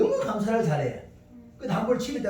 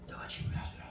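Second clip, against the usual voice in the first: whispered divine prompting; 5 dB louder than usual. Retold or imitated. God's voice prompting the man.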